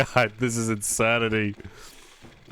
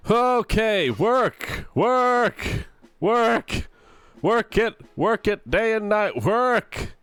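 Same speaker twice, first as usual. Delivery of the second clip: pleading with a machine; 10 semitones higher, much slower than usual. Real, not acted. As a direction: plead with the program, voice pitched up. Begging the software to work.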